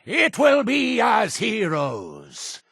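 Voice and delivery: slow, soaring sermon; exalted preaching. Acted. Cult leader promising followers a new day, exalted preaching. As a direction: proclaim the holy vision in long, high phrases.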